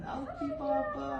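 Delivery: playful high mock cry for Papa; squeaky pretend pain. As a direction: squeak it out like a tiny hurting kid. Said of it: "may sakit pa rin".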